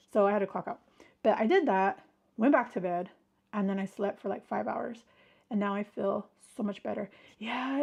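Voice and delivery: usual speaking voice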